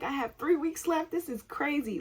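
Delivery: high-pitched mock-tearful panic; imitating a distressed wail; retold crying. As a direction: whine it high as if near tears. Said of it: "I'm, like, crying".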